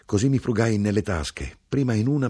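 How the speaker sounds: usual speaking voice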